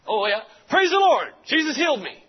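high, loud cry of praise; a healed man breaking into the sermon; joyful shout. Retold or imitated. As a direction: shout your healing to the whole room.

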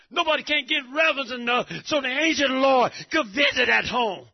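loud, driving sermon cadence; fervent preacher shouting; full-force preaching. Acted. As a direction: preach it hard to the congregation.

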